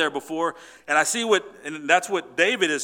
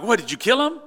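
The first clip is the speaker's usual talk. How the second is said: higher, louder startled ask; startled question; 6 semitones higher, 5 dB louder, faster than usual. Retold or imitated. David's men asking him, startled and higher. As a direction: jump in, alarmed at the news. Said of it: "They're like".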